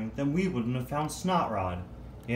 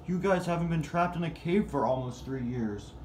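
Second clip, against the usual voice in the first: ordinary talk, nothing marked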